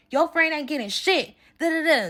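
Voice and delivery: imitating his high, sneering yell; mock the man going off on the phone; mock angry man. Teller mimics the man cursing out her friend, as a high mock yell.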